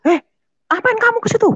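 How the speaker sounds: very high shrill mother asking; mother's voice. Retold or imitated. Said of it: "katanya gitu".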